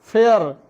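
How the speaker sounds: फेयर said loudly and clearly as fair, one syllable; teacher pronounces फेयर for the class to copy; model word fair. Shown as a demonstration. Teacher sounds out the spelled word for students, loud and clear. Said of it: "F A R E".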